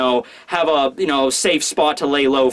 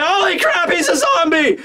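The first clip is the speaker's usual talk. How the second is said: high-pitched horrified squeal; mock panic; 13 semitones higher than usual. Reacting to the emaciated figure, mock panic. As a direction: cry out in hammed-up terror.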